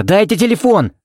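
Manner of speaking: high-pitched insistent demand; urgent cry. A narrator's reading. Desperate man demanding a phone, high urgent cry. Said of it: "крикнул он неожиданно громко".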